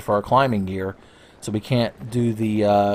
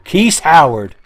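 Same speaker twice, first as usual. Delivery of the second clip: loud, higher shout of a name; mock outcry; 5 semitones higher, 10 dB louder, slower than usual. Player naming the bat enemy, loud mock outcry. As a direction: call it out like a dramatic reveal.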